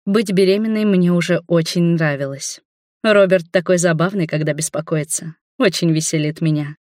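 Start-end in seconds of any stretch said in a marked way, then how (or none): none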